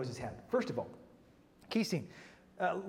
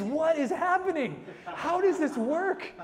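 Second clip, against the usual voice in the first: much higher, louder laughing disbelief; mock scream; 10 semitones higher, 4 dB louder, slower than usual. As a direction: cry out in laughing bewilderment. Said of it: "my conservative Reformed heart just screams".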